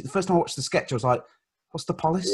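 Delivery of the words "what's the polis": "police" as high, questioning POH-liss; Scots "polis". Retold or imitated. Fan recalling his first viewing, puzzled "police" as POH-liss. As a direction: ask it puzzled, "police" rhyming with solace. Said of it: "he says the police".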